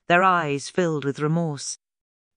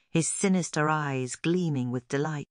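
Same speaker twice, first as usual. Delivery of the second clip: ordinary delivery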